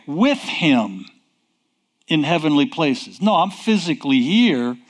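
normal talking voice

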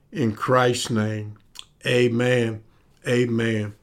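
murmured opening prayer. Acted. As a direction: pray it hushed and low. Opening prayer, quiet and low.

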